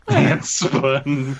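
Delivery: a lightly hammed-up slogan; mock ad voice. Repeating the milkshake slogan in a mock ad voice.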